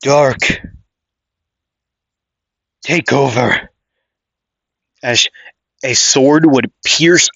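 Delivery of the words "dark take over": muffled voice. Acted. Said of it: "a muffled voice say".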